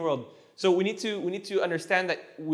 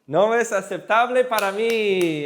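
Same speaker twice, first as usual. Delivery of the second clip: loud, higher, drawn-out echo; excited praise; 3 semitones higher, 5 dB louder, slower than usual. Real, not acted. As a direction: confirm the answer with delight. Delightedly echoing student's correct answer.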